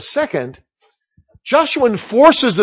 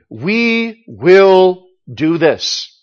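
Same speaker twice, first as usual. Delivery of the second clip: very slow, each word set down firmly, leaning on will; slow firm resolve; much slower than usual. Acted. Preacher voicing Joshua's resolve to Israel, slow and firm. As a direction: declare it slowly, like a settled decision.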